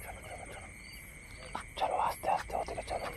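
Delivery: urgent whisper to move on. Real, not acted. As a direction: whisper so the ghost won't hear. Ghost hunt, told to stay silent. (ফিসফিস করে)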